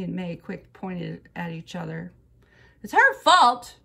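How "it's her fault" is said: high, loud blaming shout; indignant kid's yell. A narrator's reading. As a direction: shout the blame out instantly. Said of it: "we shouted together".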